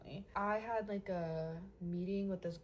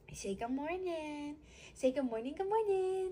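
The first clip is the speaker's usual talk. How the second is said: high sing-song good morning; 9 semitones higher than usual. Shown as a demonstration. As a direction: chirp "good morning" like greeting a pet.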